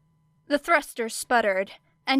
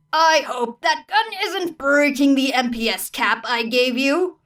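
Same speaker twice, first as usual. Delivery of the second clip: higher, louder warning teacher; stern teacher voice; 5 semitones higher, 6 dB louder than usual. Acted. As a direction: call across the gym as the mechanic teacher. Teacher Motley warns her.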